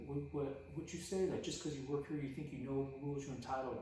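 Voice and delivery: high-pitched mock of an aggressor squaring up; imitated belligerent challenge. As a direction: imitate an angry man challenging someone, pitch way up. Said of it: "crazy dude's about to square up".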